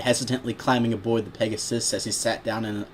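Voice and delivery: plain reading voice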